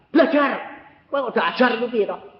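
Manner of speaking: loud, high parental order; retold scolding. Father ordering child to study, scolding.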